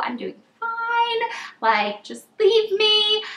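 high, slow put-on whine; mock pleading. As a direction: stretch it out high like a pitiful child. Retold pleading to be left alone.

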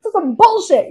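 fed-up outburst. Retold or imitated. Venting to her friend about the writers' room, fed-up outburst. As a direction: blurt it like you're done with all of it. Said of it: "You know".

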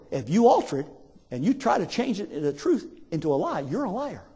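low, soft, solemn judgement; quiet grave warning. Acted. Preacher quoting God to those altering scripture, grave and quiet. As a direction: deliver the verdict softly, in pieces.

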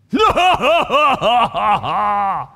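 loud, mocking belly laugh; long, booming string of ha-ha-has; taunting giant laugh. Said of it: "this idea of the taunting, and this giant".

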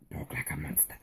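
playful low monster rumble. Pretend. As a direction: growl it like a tiny monster.

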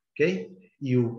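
normal talking voice

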